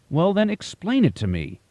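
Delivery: fed up, insist on an answer; frustrated demand. A narrator's reading. Disciple demands the masters explain, high and frustrated. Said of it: "I demanded in frustration".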